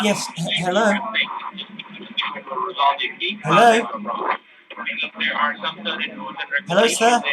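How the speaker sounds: high, loud put-on accent with rising calls; put-on caller voice. Prank caller trying to reach the IRS line, put-on voice. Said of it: "didn't really know what accent".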